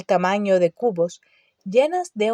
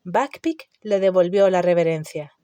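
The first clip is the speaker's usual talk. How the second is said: ordinary delivery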